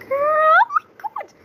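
slow, high, loud exasperated cry; exasperated outcry. Real, not acted. Gamer losing lettuce again, slow high outcry. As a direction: drag out the despair, up high.

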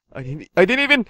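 low, quiet and halting; hushed disbelief. Acted. Player baffled that the game played itself, hushed disbelief. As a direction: stammer it softly in disbelief.

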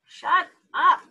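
loud, high angry shout; a sharp shouted rebuke. Acted. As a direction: yell at the chattering dog. Family shouting at Martha.

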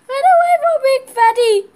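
high, loud warning shout. Real, not acted. Kid warns the team off Big Fatty, shouting. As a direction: yell at them to flee.

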